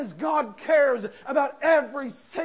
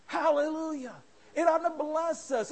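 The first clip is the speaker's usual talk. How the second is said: ordinary talk, nothing marked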